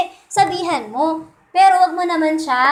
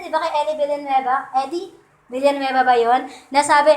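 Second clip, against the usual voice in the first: ordinary talk, nothing marked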